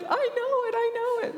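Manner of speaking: overjoyed. Retold or imitated. Thanking the stranger, overjoyed.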